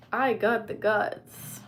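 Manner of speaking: loud and drawn out, stress on I; slow loud boast. Shown as a demonstration. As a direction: belt it out slowly, punching I.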